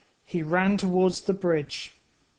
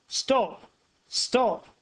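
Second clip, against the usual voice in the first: shouted; 6 semitones higher than usual. A narrator's reading. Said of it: "shouted Oscar".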